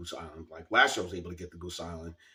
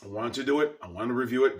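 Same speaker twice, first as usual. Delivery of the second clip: higher and louder, a real letdown; disappointed; 6 semitones higher, 9 dB louder than usual. Real, not acted. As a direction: plead that you really wanted it. Disappointed at no review.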